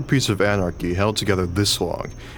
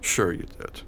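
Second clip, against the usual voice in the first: ordinary talk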